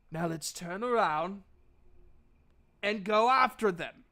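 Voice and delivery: an impassioned in-character plea, projected; frenzied rat leader. Acted. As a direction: call out commandingly with long pauses between orders. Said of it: "foaming at the mouth".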